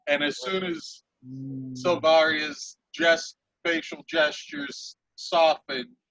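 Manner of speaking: ordinary talk, nothing marked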